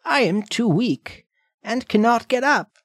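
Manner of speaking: feeble grandmother voice. Acted. Frail grandmother speaks.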